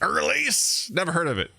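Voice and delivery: Irlise as "er-leese", rising high, then dismissive; Irlise? high, then a shrug; imitating a baffled Irlise; mock Irlise. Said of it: "He's like".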